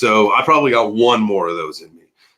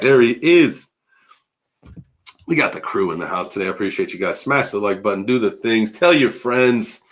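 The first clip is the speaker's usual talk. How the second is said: ordinary delivery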